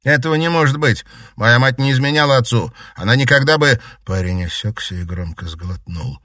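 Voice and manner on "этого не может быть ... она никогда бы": loud, fast horrified protest; horrified shout. Acted. Horrified son denies mother's affair.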